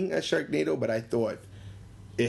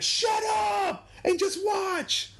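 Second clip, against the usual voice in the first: very high, drawn-out shush-them voice; high, slow mock scolding of the talkers; annoyed audience; much slower than usual. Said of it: "And everybody was like".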